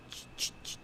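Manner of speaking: unvoiced ch-ch-ch chewing sound; whispered churning noise. Shown as a demonstration. Host imitates guinea pig chewing for listeners, whispered. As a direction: mimic the guinea pig munching softly. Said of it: "So it's just like".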